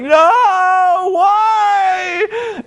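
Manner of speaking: high, shouted, crying wail of despair; anguished cry. Retold or imitated. Abyss seeing Hogan destroyed, mock crying shout. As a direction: howl at the sky over a fallen hero. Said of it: "And he's like".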